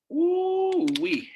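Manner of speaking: long, high-pitched "ooh-wee"; preacher's whoop. Real, not acted. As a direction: whoop high in awe at the point. Preacher reacts to own point.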